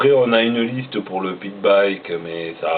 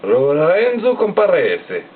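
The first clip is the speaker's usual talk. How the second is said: Lorenzo Comparese in a hammed-up Italian accent, rolled and sung out; slow put-on Italian accent on Lorenzo Comparese; 4 semitones higher, slower than usual. Shown as a demonstration. Vlogger redoes the rider's name, mock Italian accent. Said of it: "je vous le fais".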